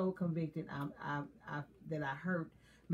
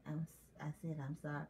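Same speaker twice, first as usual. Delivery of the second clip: soft, halting grudging repeat; reluctant repeated apology; 3 semitones lower, 9 dB quieter than usual. Retold or imitated. Wife asked by her husband to repeat her apology, reluctant and soft. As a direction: start it, stop, then get it out quietly. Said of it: "Come on, come again".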